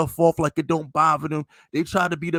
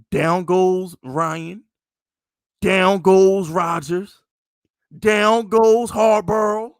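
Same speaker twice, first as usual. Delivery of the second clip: slow, louder with each name, like a boxing call; hammed-up "down goes" broadcast call; mock announcer call; 7 dB louder, much slower than usual. Sportscaster-style chant of beaten QBs.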